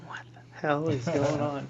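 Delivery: slow, stunned whisper; whispered disbelief. Retold or imitated. Pat to himself after the priest's advice, slow stunned whisper. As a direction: breathe it out in shock.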